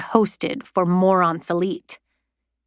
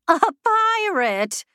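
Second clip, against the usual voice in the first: high, slow, haughty disbelief; 12 semitones higher, slower than usual. A narrator's reading. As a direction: look down your nose at it. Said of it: "said Triffine haughtily".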